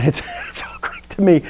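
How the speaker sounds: laughing through the words; breathy, half-voiced chuckle while talking. Real, not acted. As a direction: softly break up at your own joke. Laughs at own old joke.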